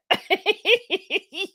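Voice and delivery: high, light giggle; soft high laughing. Real, not acted. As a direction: burst into a real giggle. Amused by a viewer's comment.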